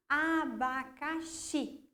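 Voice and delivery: abacaxi drawn out slowly, leaning on the final xi; stress on last syllable. Shown as a demonstration. Teacher showing pupils the tonic syllable, abacaxi stretched to a stressed xi. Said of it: "coloca mais entonação nessa palavra".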